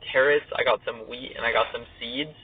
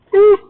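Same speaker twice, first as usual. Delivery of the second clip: excited woo; 14 dB louder than usual. Real, not acted. Sudden cheer alone on line.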